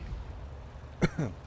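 short cough after a sneeze; real cough. Real, not acted.

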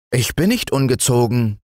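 put-on childlike defiance; defiant. A narrator's reading. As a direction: protest like a stubborn child. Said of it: "antwortete Fritz trotzig".

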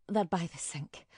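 breathless whisper through a migraine; a pained whisper to herself. Acted. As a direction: whisper it, head pounding. Migraine, fetching pills.

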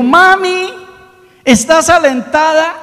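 very high, loud, happy call to mom; excited child call. Acted. Boy runs home and calls his mother, excited shout. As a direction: call out to mom full of joy.

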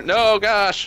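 high, loud indignant no; mock indignant refusal. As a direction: playfully refuse. Acted refusal in skit.

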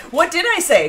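ordinary talk, nothing marked

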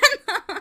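short high laugh; burst of laughter. Real, not acted. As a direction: laugh out loud. Laughing at the troll.

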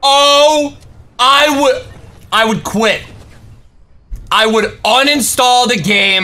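loud, stunned outburst; disbelieving shout. Real, not acted. Reacting to enemy floating down.

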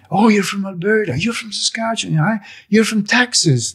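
high, excited hellos; delighted greetings. Retold or imitated. Voicing convoy arrivals greeting each other, high and delighted.

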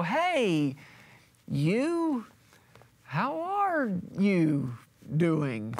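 drawn-out, halting greeting with swooping pitch; acted bluffing, slow uncertain hello; awkward stalling. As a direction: stretch each word while you try to place the caller. Acts stalling, unable to place caller.

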